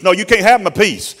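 high, loud whooping sermon cadence; full-force preaching. Real, not acted. Preacher whooping the climax at the congregation. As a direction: ride the rhythm all the way up.

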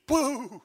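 imitated burst, loud drawn-out boo; a long high boo for tears exploding; explosive boo. Grandfather imitating his grandchild bursting into tears, explosive boo. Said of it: "he just blowed up crying".

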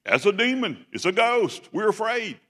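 high, alarmed cries; mock panic, pitched high; frightened disciples. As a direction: cry out as if spotting a ghost. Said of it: "what's their reaction to him coming".

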